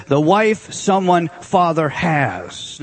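slow, word-by-word Greek order; literal word order. Shown as a demonstration. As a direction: lay out each word separately, slowly. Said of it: "let me just do the Greek for you".

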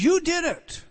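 You did it, high and loud; exclaimed accusation. Real, not acted. Preacher exclaiming the listeners' guilt, high and loud. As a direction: fling it up high.

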